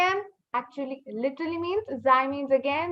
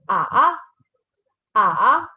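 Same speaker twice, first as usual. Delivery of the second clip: "ah" falling low, then lifting back up; third tone; 3 semitones lower, 5 dB louder than usual. Shown as a demonstration. Teacher shows learners the third tone, dipping "ah". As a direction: dip "ah" like a hook in the throat. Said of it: "that is the third tone".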